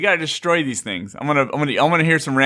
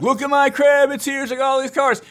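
mock show-off voice, much higher; show-off bragger; 12 semitones higher than usual. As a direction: brag about your huge crib like a flashy guy. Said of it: "these guys who are super like".

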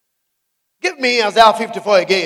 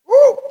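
excited whoop; 5 dB louder than usual. Real, not acted. Preacher exclaims.

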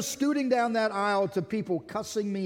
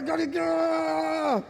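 road rage; 8 semitones higher, much slower than usual. Acted. Preacher contrasting angry driver with friendly one, enraged yell. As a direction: explode like a raging driver.

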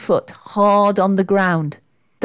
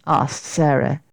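plain reading voice

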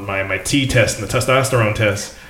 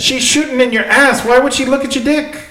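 incredulous; 14 semitones higher than usual. Real, not acted. Incredulous retort to friend.

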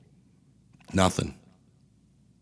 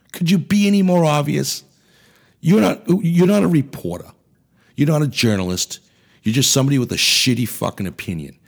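angry rant; 8 semitones higher, 4 dB louder than usual. Real, not acted. Ranting at the news media.

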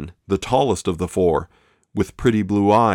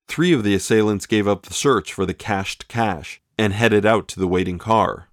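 ordinary delivery

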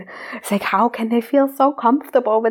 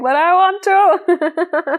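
coy whine; 8 semitones higher, much slower than usual. Retold or imitated. Mock guilty desire.

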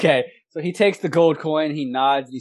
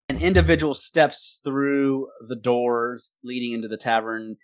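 normal talking voice

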